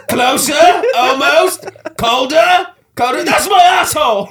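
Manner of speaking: high, loud cartoon character voice; high squeaky character shout; voiced clit character. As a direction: guide them in like a cheeky creature.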